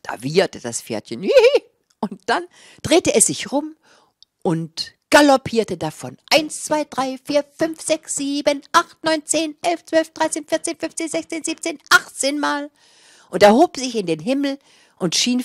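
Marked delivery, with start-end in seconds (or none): 1.2-1.6 high, loud hihi whinny; high giggling neigh of a pony; horse giggle neigh. A narrator's reading. 6.3-12.7 high, eager counting of leaps; counting the galloping leaps, high and excited. A narrator's reading.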